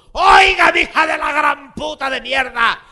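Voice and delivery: very high, loud furious yell; shrill enraged outburst; furious shout. Retold or imitated. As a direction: scream at the clerk in rage. Uncle yelling at clerk.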